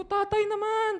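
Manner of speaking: mock annoyed high cry of protest; exasperated complaint. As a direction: plead at the tricycle driver in mock exasperation. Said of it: "Sabi ko".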